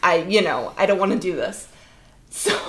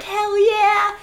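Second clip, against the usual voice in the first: imitating kids; 11 semitones higher than usual. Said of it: "all these kids are like".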